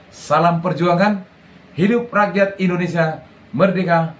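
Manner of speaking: rousing patriotic rally shout; rally speech. Acted. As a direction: call out to a crowd. Closing patriotic rally call.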